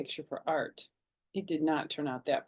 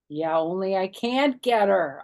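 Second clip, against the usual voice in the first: upset; 2 semitones higher, 7 dB louder than usual. A narrator's reading. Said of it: "I said, real upset".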